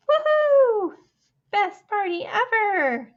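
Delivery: joyful party whoop; excited cheer. Acted. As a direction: cheer like the party just started.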